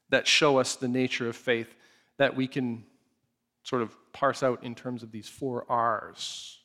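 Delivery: ordinary delivery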